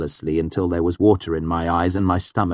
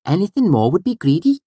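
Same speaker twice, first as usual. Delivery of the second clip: high, louder chiding little voice; Auri; 12 semitones higher, 6 dB louder than usual. A narrator's reading. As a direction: scold him gently, pitched high. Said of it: "she chided me gently".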